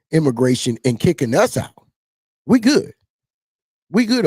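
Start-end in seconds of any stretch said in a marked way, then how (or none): 2.4-4.2 high, louder defiant "We good". Real, not acted. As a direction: throw it back at them, higher. Defiant declaration.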